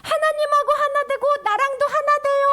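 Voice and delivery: 높고 크게 외치는 권유; 멀리 있는 사람을 부르듯; calling out. Shown as a demonstration. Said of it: "하고 외치는 거야". (높고 크게 외치기)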